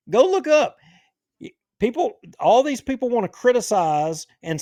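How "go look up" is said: louder, higher insistent push; emphatic. Real, not acted. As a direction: drive it home hard. Heated rant, urging listeners.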